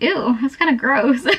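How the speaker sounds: playful mock disgust; grossed out. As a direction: recoil like something's icky. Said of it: "grossed out".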